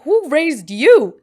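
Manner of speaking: high, loud and slow, appalled; outraged scold. Retold or imitated. Girl scolding a rude boy on video chat, high loud slow outrage. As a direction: scold him like a mother.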